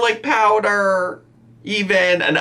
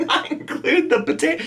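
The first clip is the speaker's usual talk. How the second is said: high-pitched pet voice, laughing lead-in; playful mock protest in a high voice; pet's voice; 11 semitones higher, faster than usual. Pet protesting being left out of cheese talk, high voice. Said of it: "He said".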